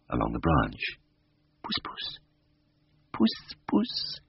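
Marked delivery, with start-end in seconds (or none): 1.6-4.2 very soft, high, sweet coaxing; honeyed coo. A narrator's reading. Vet reaches for the cat's face, cooing honey-sweet. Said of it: "My voice was like molten honey".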